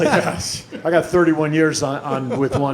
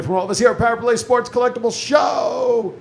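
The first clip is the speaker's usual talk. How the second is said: big announcer shout; 10 semitones higher than usual. Shown as a demonstration. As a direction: belt it like an arena announcer. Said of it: "as I always say".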